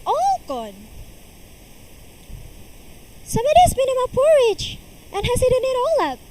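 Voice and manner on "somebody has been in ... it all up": very high, fast, loud squeak; a tiny baby bear crying out; little small wee voice. A narrator's reading. Baby bear finds his porridge gone, in a tiny high voice. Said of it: "in his little small wee voice".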